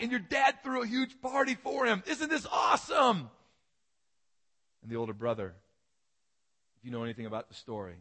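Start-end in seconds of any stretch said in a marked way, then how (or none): 0.0-3.3 much higher and louder, bursting with news; excited servant. Acted. Servant rushing to tell the older brother his brother's back, thrilled. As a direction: gush like a servant who can't hold it in.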